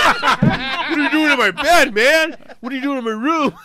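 mock-outraged drunken protest; retold outrage. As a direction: holler it in disbelief, half laughing. Retold drunk intruder's outraged question.

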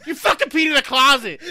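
high, loud mock accusation; retold furious outburst; imitated angry wife. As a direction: yell the accusation, half laughing. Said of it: "angry ass eyes".

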